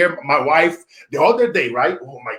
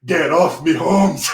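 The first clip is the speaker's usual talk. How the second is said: mock Mexican punchline voice; a hint of a Mexican accent on the punchline; dad doing the joke's punchline in a light Mexican accent; Mexican accent, lightly done; slower than usual. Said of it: "He said".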